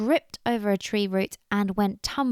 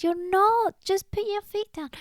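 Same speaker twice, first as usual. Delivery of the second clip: high-pitched insistent retort; exasperated calling out; 12 semitones higher, 3 dB louder than usual. Acted. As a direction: call back across the river. Squirrel shouts back from far.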